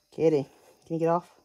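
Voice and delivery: usual speaking voice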